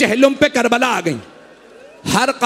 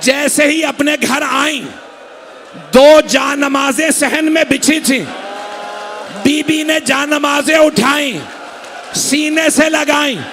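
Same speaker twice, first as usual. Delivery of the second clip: building sermon; 4 semitones higher than usual. Acted. Preacher driving mourners toward the climax, building to a shout. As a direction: climb toward the climax line by line.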